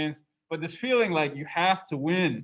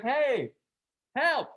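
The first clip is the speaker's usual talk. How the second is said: high-pitched imitated yell of hey, help; mock cry for help; 8 semitones higher than usual. Demon mimicking Rama calls for help, higher mock yell. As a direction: call out as if in danger, half-acted. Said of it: "Maricha yells out in Rama's voice".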